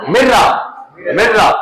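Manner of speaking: मिर्रा with a long rolled double r, drilled; teacher models मिर्रा for the class to copy; mirra. Shown as a demonstration. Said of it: "इसको क्या पढ़ेंगे?".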